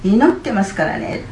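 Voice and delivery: imitating the pastor calling it out firmly; loud retold pastor. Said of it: "って大きな声で言われた時に".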